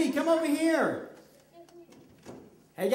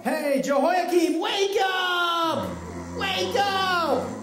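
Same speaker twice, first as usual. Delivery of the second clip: shouting to wake; 6 semitones higher than usual. Acted. Calling the sleeping king.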